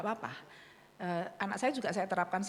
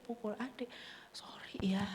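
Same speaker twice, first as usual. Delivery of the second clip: soft apology; 6 dB quieter than usual. Retold or imitated. Voicing mother's apology softly.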